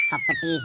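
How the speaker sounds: a high piercing yelp as the blow lands; shrill cry of the hit; sharp slap cry. Acted. Shivappa striking the landlord, high yelping cry.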